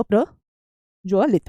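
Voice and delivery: प्रज्वलित very slowly, pausing after "pra"; syllable-by-syllable. Shown as a demonstration. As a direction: break प्रज्वलित into "pra... jva-lit". Teacher breaks word up for students.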